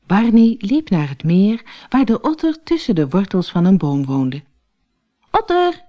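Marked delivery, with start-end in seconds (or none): 5.3-5.8 calling out. Acted. Stoat calls the sleeping otter awake, high and urgent. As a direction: call up into the tree roots, urgent. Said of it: "riep Barney".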